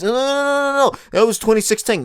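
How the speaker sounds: high-pitched mock correction; objecting know-it-all. Imagined viewer corrects the meme's year, mock pedantic protest. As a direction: cut in with a string of high protesting noes.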